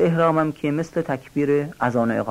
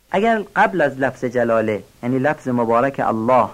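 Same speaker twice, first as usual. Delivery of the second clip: ordinary delivery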